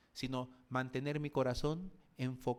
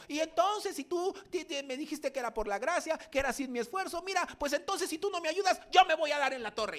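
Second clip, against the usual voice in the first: much higher, loud indignant whine; demanding believer; 13 semitones higher, 5 dB louder than usual. Retold or imitated. Believer blaming God for no help, high indignant whine. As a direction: threaten God like a sulking child. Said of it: "cuando se ha sentido en la cruz, como".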